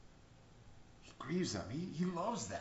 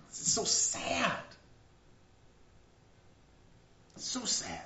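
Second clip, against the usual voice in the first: sorrowful; 7 semitones higher, much slower than usual. Acted. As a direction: let the sadness sink in. Grieving over Pharisees.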